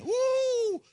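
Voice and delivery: high, loud whoop; imitated crowd cheer, high-pitched "woo"; crowd shouting. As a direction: whoop like an excited congregation. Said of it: "everybody was shouting".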